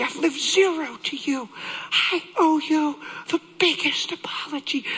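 high, pleading re-enacted confession; tearful confession. Husband confessing to his wife, anguished. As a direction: blubber out the guilt, pitched high.

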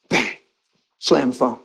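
usual speaking voice

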